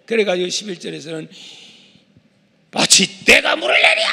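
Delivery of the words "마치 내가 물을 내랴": angry outburst in another voice; Moses. Acted. Preacher playing Moses at the rock, angry. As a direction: burst out with an indignant challenge. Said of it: "열 받은 것 같아요".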